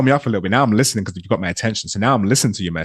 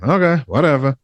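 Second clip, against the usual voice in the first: mock indifferent brush-off, pitched up; unbothered; 5 semitones higher than usual. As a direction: shrug it off as if you don't care. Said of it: "when you don't care about something".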